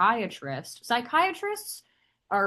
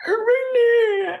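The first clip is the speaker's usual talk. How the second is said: much higher, louder incredulous squeal; gossiping hometown acquaintance in shocked disbelief; mock incredulous; 9 dB louder than usual. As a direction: Rhode Island acquaintances reacting to the video, squealed disbelief. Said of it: "the Rhode Islanders ... be like".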